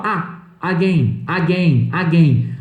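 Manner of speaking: AGAIN as "AH-gain", full open A; learner's "ah-gain", repeated; Brazilian mispronunciation. Retold or imitated. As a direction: lean on the first A of AGAIN. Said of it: "a gente fala".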